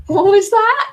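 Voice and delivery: high, loud incredulous question; like the customer just did something absurd; baffled disbelief. Retold or imitated. Artist baffled by a rude customer, high incredulous cry. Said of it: "And I was like".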